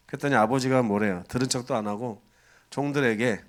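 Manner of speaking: usual speaking voice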